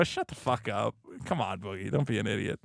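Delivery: low, quiet brush-off; unimpressed dismissal. Pretend. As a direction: drop low and soft, dismissing nonsense. Imagined dismissal of an absurd claim.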